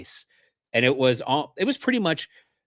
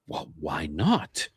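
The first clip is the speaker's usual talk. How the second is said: slow, lower, offended "why not"; 8 semitones lower, 4 dB quieter, much slower than usual. Retold or imitated. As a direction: drag it out like she's taken aback. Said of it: "deeply offended by this".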